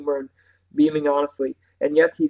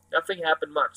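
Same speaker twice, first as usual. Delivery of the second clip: ordinary delivery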